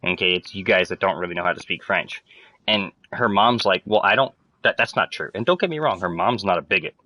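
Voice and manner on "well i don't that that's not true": ordinary delivery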